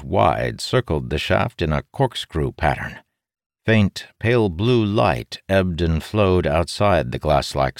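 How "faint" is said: plain reading voice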